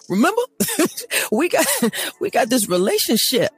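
high, laughing reminder to God; laughing plea. Retold or imitated. To God, reminding him of their relationship, laughing. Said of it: "some people don't walk in their power of".

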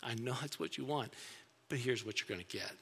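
quietly knowing, like a father who already decided; God's reply. Pretend. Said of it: "he can determine by looking at us saying".

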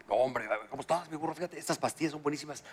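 voz baja y grave de vendedor; vendedor confidencial imitado. Acted. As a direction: recomienda en confianza, bajito y grave. Imita al vendedor del sex shop. (imitando al vendedor, en voz baja)

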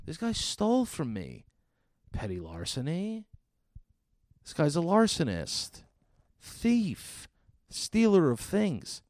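high-pitched comic outrage; mock-outraged name-calling; indignant. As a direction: accuse him, pitch leaping up on each charge. Indignant rant about the thief.

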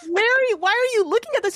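high-pitched, incredulous questioning of dad; mock appalled protest. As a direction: replay your dismay way up high. Acting her protest to dad.

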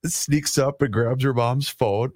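usual speaking voice

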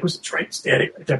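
hushed, half-whispered quote; retold whisper. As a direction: imitate an urgent whisper lightly. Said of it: "He's whispering".

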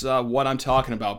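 normal talking voice

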